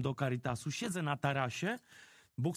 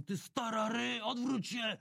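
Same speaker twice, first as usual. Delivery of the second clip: higher, drawn-out mock thug shout; thug yelling at grandma; 8 semitones higher, slower than usual. Thug on the bus shouting at an old woman, hammed-up yell.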